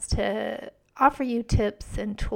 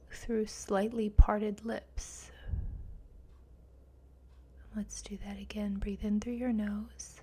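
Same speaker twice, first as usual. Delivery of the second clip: guided meditation; 4 dB quieter than usual. Acted. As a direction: guide them gently into calm.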